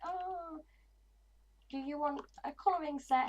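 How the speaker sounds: put-on high, sing-song talk to a little kid; sweet voice to a child. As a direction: coo it like offering a treat. Talking sweetly to nice kids.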